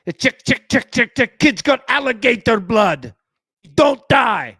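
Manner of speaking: high, loud, staccato character impression; hammed-up shouting imitation; shouted movie quote. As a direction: rattle it out like a hollering film villain. Quoting a Rounders character loudly.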